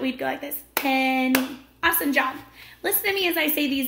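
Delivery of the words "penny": "penny" split slowly, PEN-NY; clapped into syllables. Shown as a demonstration. Teacher showing the class how to clap penny, clapped syllables. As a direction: say "penny" in two separate clapped beats. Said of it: "if we were to clap it".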